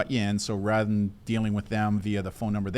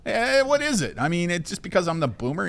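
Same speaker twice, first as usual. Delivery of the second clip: higher, louder mock outrage; mock indignant; 9 semitones higher, 5 dB louder than usual. Real, not acted. Host mock-offended at co-host, higher and louder. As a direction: play up being the offended boomer.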